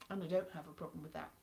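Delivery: ordinary talk, nothing marked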